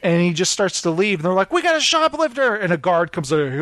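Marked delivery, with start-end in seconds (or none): 1.5-2.5 high-pitched mock alarm; retold alarm. Store clerk spotting a shoplifter, high mock alarm. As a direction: call out a shoplifter, high and urgent.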